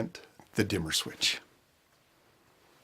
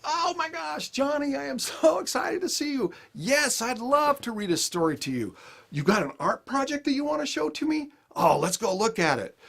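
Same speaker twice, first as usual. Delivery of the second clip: much higher, laughing, gushing delight; overjoyed greeting; 13 semitones higher than usual. Retold or imitated. Dad greeting Johnny after letting go of his anxiety, gushing and laughing. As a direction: burst with joy at seeing your son.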